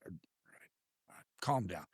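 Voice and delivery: low, soft reply to calm him; retold as a hushed, grudging giving-in; mock placating. Placating his insistent friend in retold quote.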